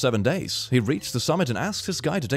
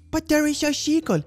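cooing; 14 semitones higher than usual. Real, not acted. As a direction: coo over the seagull. Cute seagull drinking.

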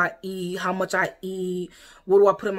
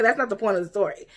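usual speaking voice

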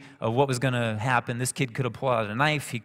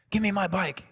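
angry demand; 8 semitones higher than usual. Retold or imitated. Kid repeats his demand angrily.

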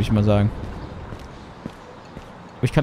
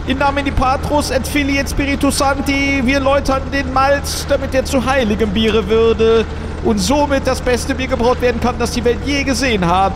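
priestly blessing; 8 semitones higher than usual. Acted. Mock Latin blessing.

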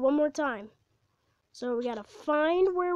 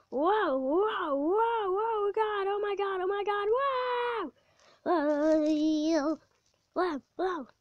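alarmed whoas; 4 semitones higher, slower than usual. Real, not acted. Riding a wild coaster.

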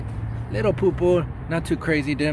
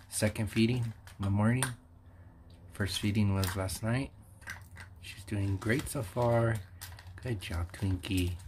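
quiet; 4 semitones lower, 10 dB quieter than usual. Real, not acted. Early morning feeding, hushed.

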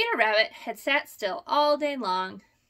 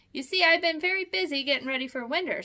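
fast, higher excuse; hurried excuse; 6 semitones higher, 4 dB louder, faster than usual. A narrator's reading. Peter excusing himself to Uncle Billy, hurried. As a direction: rush out the excuse. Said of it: "by way of an excuse".

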